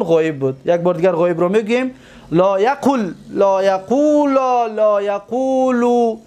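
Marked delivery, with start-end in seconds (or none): 2.3-6.2 slow recitation with long held vowels; paradigm recitation. Shown as a demonstration. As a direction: stretch each long vowel evenly. Teacher recites the verb paradigm.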